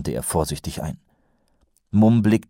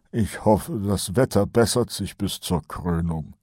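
worried mumble; 2 semitones higher than usual. A narrator's reading. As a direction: mutter it anxiously as the sergeant. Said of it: "murmelte Kolon besorgt". (besorgt gemurmelt)

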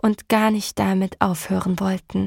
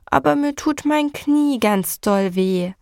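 put-on higher child's voice, grumbling; 5 semitones higher than usual. Boy complaining to his mother about his knee, grumbling child. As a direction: grumble it like a hurt little boy. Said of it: "grummelte Tom".

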